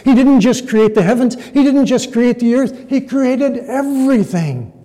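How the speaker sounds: high, insistent preaching; emphatic preacher. Acted. Preacher arguing for the verse's ending, high and emphatic. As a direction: preach it in driving parallel phrases, pitched high. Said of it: "still other preachers emphasize".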